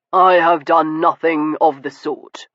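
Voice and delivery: level, unruffled reply; calm denial. Acted. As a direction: answer evenly, refusing the bait. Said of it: "Stoker said calmly".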